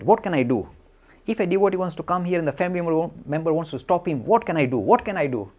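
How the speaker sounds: imitating agitated exasperation. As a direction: plead loudly, worked up and helpless. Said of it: "looking very agitated".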